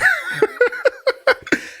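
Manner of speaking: high, shrieking laugh; burst of laughter. Real, not acted. Host cackling at Tofia's story, shrieking laugh. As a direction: cackle at how absurd it sounds.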